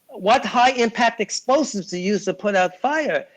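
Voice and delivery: high, fast mock-innocent questions; hammed-up clueless asker; mock naive questioner. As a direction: play dumb in a squeaky voice. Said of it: "I go".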